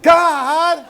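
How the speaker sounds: high, loud cry of frustration; exasperated shout. Acted. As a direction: burst out in mock exasperation. Stuck at light, acted frustration.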